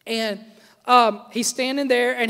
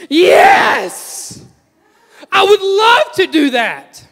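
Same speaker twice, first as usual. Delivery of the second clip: put-on high, loud, eager yell of a kid; excited child shout; 9 semitones higher, 7 dB louder, much slower than usual. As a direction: belt it out like a thrilled child. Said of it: "Julia goes".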